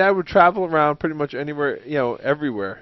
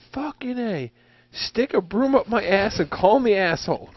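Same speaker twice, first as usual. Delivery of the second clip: imitating dad's outburst, pitched up; dad cursing at a stuck job, higher voice; retold cursing; 7 semitones higher than usual. Dad's swearing heard from upstairs.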